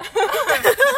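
high rising laugh; loud laugh. Real, not acted. (大笑い)

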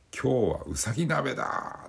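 high slow gleeful shout. Retold or imitated. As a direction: announce it like a crazed chef. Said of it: "って叫んだ".

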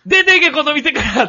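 high, loud comic order to leave; hammed-up angry yell at an outsider; mock hostile Kansai local. Imagined shop local throwing out a Hakata man, mock angry yell. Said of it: "って言われるかもしれん".

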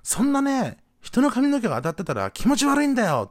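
high-pitched mock rant; acted-out indignant scolding; furious older man. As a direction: imitate an irate stranger, voice climbing high. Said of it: "おっさんまだぶっちぎれてて".

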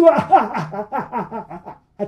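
loud evil laughter, ringing on; booming laughter. A narrator's reading. Endless laughter echoes from behind the door. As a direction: keep the laugh rolling. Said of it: "向こうから聞こえてくるの".

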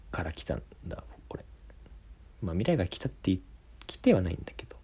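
hushed, secretive whisper. Shown as a demonstration. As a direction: whisper a grave confession. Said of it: "ささやき声で". (思い詰めたささやき声で)

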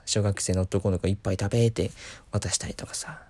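ordinary talk

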